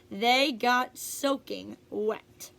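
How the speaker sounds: plain reading voice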